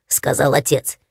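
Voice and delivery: ordinary delivery, nothing marked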